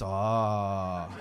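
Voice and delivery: long, low "oh"; crowd's groan. Retold or imitated. Comic retelling the crowd's reaction, long low groan.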